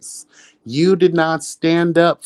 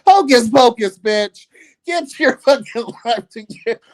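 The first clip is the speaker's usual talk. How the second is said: much higher, put-on witch voice for the insult; lightly hammed Hocus Pocus witch; mock witch taunt; 9 semitones higher than usual. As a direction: hex her like a movie witch.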